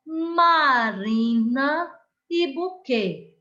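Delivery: Marina e buquê, slow, with RI and QUÊ leaned on; teacher modelling word stress slowly; stressed syllables. Shown as a demonstration. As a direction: spell out the strong syllables slowly. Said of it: "há uma sílaba mais forte".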